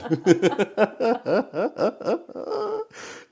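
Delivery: high, breathy laughing fit; helpless giggling; real laughter at the joke; long laugh. Real, not acted.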